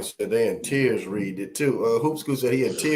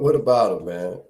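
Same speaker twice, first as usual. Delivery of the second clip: ordinary talk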